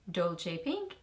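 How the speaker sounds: ordinary talk, nothing marked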